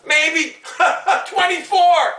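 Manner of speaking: normal talking voice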